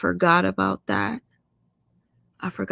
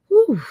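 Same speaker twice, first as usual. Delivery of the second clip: overwhelmed whew; 13 semitones higher, 7 dB louder than usual. Real, not acted. Overwhelmed by the story.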